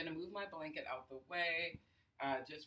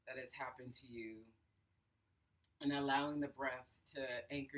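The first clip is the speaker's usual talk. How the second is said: guided breathing. Acted. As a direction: count the breaths, unhurried and soothing. Leads a breathing exercise.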